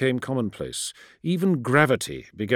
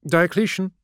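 raised, higher call; calling out over vox; 9 semitones higher, 6 dB louder than usual. Acted. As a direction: call his name over the radio. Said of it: "she voxed".

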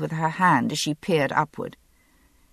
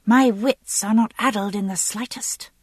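put-on higher-pitched, huffy young lady; petulant indignation; 5 semitones higher than usual. A narrator's reading. Young lady contradicting the groom, petulant. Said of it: "stamped her Morocco-booted foot".

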